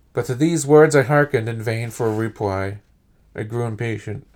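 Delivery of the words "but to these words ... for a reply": usual speaking voice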